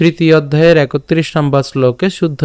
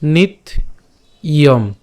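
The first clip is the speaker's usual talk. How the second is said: নিত then a pause, then ইয়ম, broken into two parts; syllable split নিত … ইয়ম; much slower than usual. Shown as a demonstration. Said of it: "নিত্যম উচ্চারণটা কেমন করলাম?".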